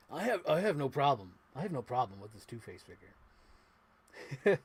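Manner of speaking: lightly voiced wrestler; a hint of a wrestler's promo; Kevin Nash. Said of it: "As, uh, Kevin Nash would say".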